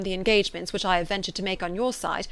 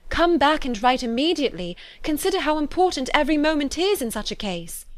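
higher-pitched urgent pleading; earnest entreaty; 6 semitones higher than usual. A narrator's reading. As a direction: urge him to act at once. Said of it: "Most earnestly did she then entreat him".

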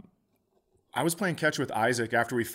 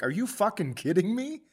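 high shocked disbelief; incredulous; 7 semitones higher, 4 dB louder than usual. Retold or imitated. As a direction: react like you can't believe it. Disbelief at short drive.

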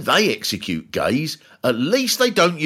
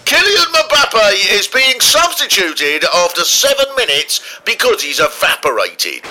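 commentator; 8 semitones higher, 6 dB louder than usual. Retold or imitated. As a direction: call it like a live match commentator. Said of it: "the commentators will say".

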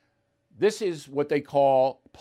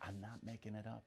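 much softer, lower aside; muttered denial; 7 semitones lower, 23 dB quieter, faster than usual. Real, not acted. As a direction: say it almost under your breath. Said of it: "You think I'm making this up?".

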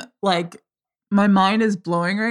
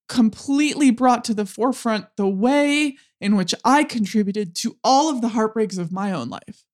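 ordinary delivery

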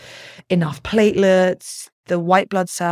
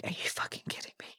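disbelieving whisper; 18 dB quieter, much faster than usual. Retold or imitated. Retold disbelief at test results.

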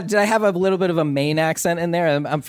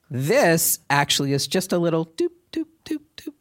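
tapping doop sounds; 3 semitones higher than usual. Retold or imitated. Mimics the light tattoo needle.

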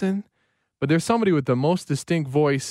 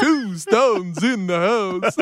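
slow, laughing Count voice; mock Count tally, slow; 4 semitones higher, much slower than usual. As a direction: count the stones like a vampire. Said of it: "Just count for us".

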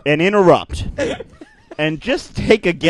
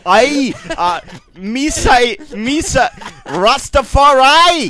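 mock Jamaican; 5 semitones higher, slower than usual. Switches to Jamaican bit.

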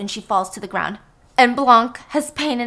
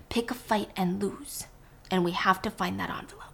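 whisper; 3 semitones lower, 9 dB quieter than usual. Acted. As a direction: plot in a low whisper. Blanc whispering instructions.

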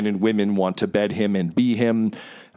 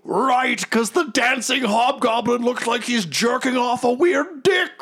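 high, uproarious hero voice; delighted blowhard. Acted. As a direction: crow with delight at the joke. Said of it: "his face just lights up".

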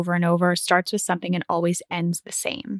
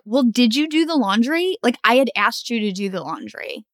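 acted accusing question, pitched up then dropping; mock nagging; 3 semitones higher than usual. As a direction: play the exasperated partner checking up. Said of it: "as opposed to".

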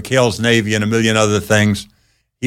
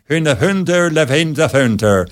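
mock Swedish gibberish; hammy fake Swedish accent; 4 semitones higher than usual. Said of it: "talking some fake Swedish voice".

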